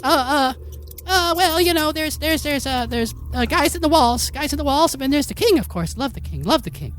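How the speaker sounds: very high squeaky babbling rat; a flustered rat rambling about its king; nervous rat. Acted. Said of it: "He's like".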